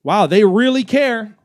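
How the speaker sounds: loud, drawn-out mock wonder; sarcastic amazement. Real, not acted. Host mocks months-long permit waits, loud sarcastic amazement.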